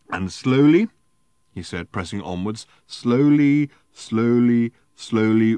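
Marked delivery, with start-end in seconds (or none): 0.1-0.9 loud, drawn-out "slowly"; dramatic emphasis. Acted. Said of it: "he paused for effect". 2.9-5.6 each "slowly" loud, then fading; dramatic repetition. Acted. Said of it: "he paused for effect".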